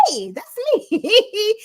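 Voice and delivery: high, loud, drawn-out squeal with a laugh; giddy retold surprise; delighted recognition. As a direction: squeal it slowly, laughing at yourself. Reenacts spotting her own picture.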